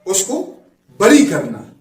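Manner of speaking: ordinary talk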